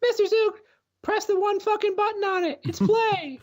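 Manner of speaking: put-on very high falsetto child; exasperated pupil in a squeaky put-on voice; schoolkid. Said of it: "Can one of you kids".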